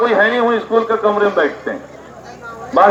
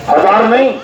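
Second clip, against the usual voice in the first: ordinary talk, nothing marked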